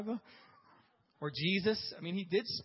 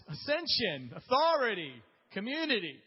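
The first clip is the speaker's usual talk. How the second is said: mock buzzwords; 6 semitones higher, 6 dB louder than usual. Mock buzzword list, laughing.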